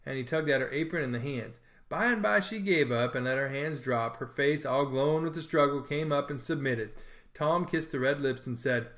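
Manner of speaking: ordinary delivery, nothing marked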